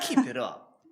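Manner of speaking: high, loud hype coach cheer; instructor voice. Acted. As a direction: pump them up like a gym instructor. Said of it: "Let me put my instructor voice on".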